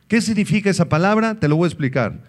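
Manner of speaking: ordinary talk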